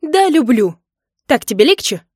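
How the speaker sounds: loud, high angry confession; defiant shout. A narrator's reading. Wife confessing love for another to husband, defiant shout. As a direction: shout it to hurt him.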